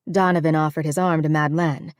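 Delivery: plain reading voice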